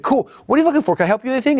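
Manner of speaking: high, chirpy offer of help; eager salesperson. Acted. Salesperson asking a customer what he needs, high and eager. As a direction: sound thrilled to help.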